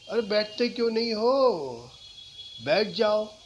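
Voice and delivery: why won't you sit, pushy; impatient. Shown as a demonstration. Teacher demoing an impatient order to sit. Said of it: "इसके कहने के कई तरीके हैं".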